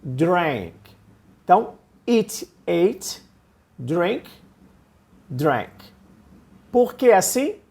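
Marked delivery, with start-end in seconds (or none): 0.0-0.7 "Drank" vowel like E, not A; drank with an E sound. Shown as a demonstration. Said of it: "vou falar como se fosse um E". 5.3-5.7 "Drank" vowel like E, not A; drank with an E sound. Shown as a demonstration. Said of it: "vou falar como se fosse um E".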